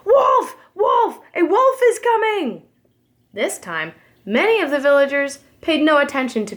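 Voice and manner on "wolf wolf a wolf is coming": high, loud cry for help; shouting. Acted. Shepherd boy shouting a fake alarm again, loud high yell. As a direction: yell it out to the whole village. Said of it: "again started shouting".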